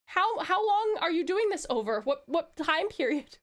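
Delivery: ordinary delivery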